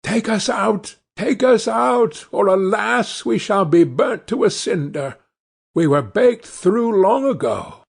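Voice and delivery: high, pleading little bread voices calling for help; loaves crying out. A narrator's reading. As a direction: squeak out a plea from inside the oven, the "out" stretched long. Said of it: "the loaves cried out to her".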